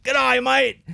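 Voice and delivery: high, loud Australian G'day; mock Australian accent. Mocking people who wake up Australian, Australian accent. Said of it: "all of a sudden they're like".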